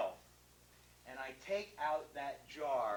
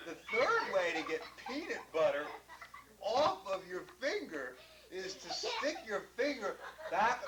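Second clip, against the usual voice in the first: laughing; 6 semitones higher, 3 dB louder than usual. Real, not acted. Laughing while demonstrating.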